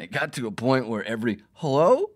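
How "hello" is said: high, much louder rising call of hello. Retold or imitated. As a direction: shout hello like into a noisy phone line. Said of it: "he kept saying".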